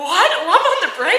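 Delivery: high, loud, laughing disbelief; astonished echo. Retold or imitated. Driver hearing surprise song choice, incredulous question. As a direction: repeat it as a stunned question.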